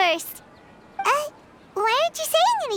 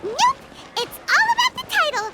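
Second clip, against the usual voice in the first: excited; 9 semitones higher than usual. Real, not acted. Paimon boasting about her title.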